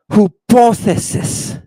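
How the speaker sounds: "Hypothesis" slow and high, starting "hoo-POTH"; Greek pronunciation. Shown as a demonstration. Teacher sounding out Greek for students, "Hypothesis" as "hoo-POTH-esis". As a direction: say "Hypothesis" with a "hoo" first syllable, drawn out. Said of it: "That's your hypothesis".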